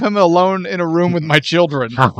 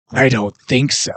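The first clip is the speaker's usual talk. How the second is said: lower-pitched movie quote; imitated Raiden; 7 semitones lower than usual. Quoting Raiden to settle the accent argument, light imitation. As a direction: lightly mimic the actor's delivery. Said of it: "clip of him going".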